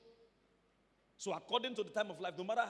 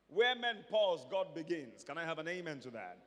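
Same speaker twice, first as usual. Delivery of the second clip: usual speaking voice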